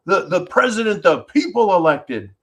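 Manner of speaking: high-pitched mocking finger-pointer, easing down at the end; mock accuser. Host voicing those who'd blame the crowd, mock accusing. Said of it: "So you could go".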